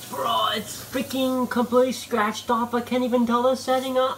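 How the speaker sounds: high-pitched, incredulous complaint; exasperated. Real, not acted. Guest showing viewers the scratched-off oven settings, exasperated. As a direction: complain high, at wits' end.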